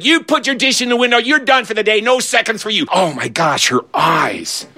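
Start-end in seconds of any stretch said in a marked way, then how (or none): 0.0-2.9 higher, fast scolding lunch lady; stern lunch lady. Retold or imitated. Lunch lady catching Mike, higher fast scolding. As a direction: snap the orders like a cross lunch lady.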